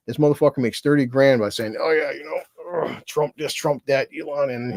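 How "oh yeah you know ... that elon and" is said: a lightly voiced smug talking head; mocking a pundit. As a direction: drawl it like a smug commentator you despise. Mocking a pundit's easy money talk.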